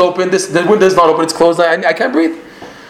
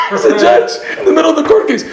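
laughing disbelief; 14 semitones higher, 3 dB louder than usual. Real, not acted. Amazed aside while laughing.

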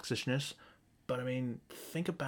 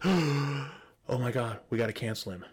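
louder, slow drawn-out oh my God; mock outraged prude; 5 dB louder than usual. An outraged critic calling for cancelling, mock scandalized. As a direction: parody a scandalized cancel-culture scold. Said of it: "somebody out there who goes".

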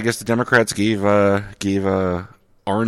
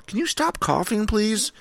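high-pitched, put-on plea to stop; mock exasperation; 11 semitones higher, 5 dB quieter than usual. Host scolding co-host for coughing mid-intro, mock exasperated.

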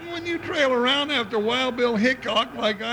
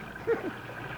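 wheezy laugh; 7 semitones higher, 10 dB quieter than usual. Acted. Jingles laughs at own joke.

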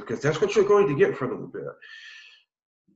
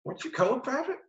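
questioning drill sergeant; 13 semitones higher, 3 dB quieter than usual. Retold or imitated. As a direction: question a recruit in a put-on sergeant voice. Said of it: "parade rest".

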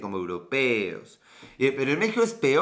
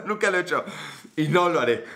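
laughing; faster than usual. Real, not acted. Laughing denial.

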